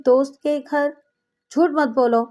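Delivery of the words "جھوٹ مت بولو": usual speaking voice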